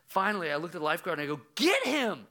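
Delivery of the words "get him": high, sharp yell; retold scream. Dad re-enacts screaming at the lifeguard, angry yell. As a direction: yell the order across the pool. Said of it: "Like, I'm screaming at the lifeguard".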